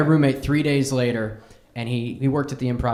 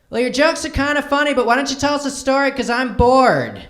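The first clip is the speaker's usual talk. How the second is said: mock heckler voice, much higher, dragging out the bored ending; 9 semitones higher, 4 dB louder than usual. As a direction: play a lazy woman heckling from the front row. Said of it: "this woman who was right up front laying down on her boyfriend goes".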